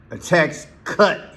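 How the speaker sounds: slow and high on "A tax cut", leaning hard on "cut"; stressed tax cut. Retold or imitated. Host imitating Trump's emphasis, slow and high on "cut". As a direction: hammer the word "cut" the way Trump did. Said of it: "I put that emphasis on that word".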